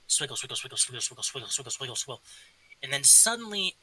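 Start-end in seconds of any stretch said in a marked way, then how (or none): 0.1-2.2 soft, lower, fading little chant; tiny kobold voice. Acted. Kobold under the table, a tiny voice chanting softly. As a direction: murmur it to yourself, fading away. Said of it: "the tiny voice going".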